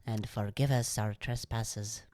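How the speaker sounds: put-on soft, slow Irish accent prayer. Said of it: "in your Irish accent".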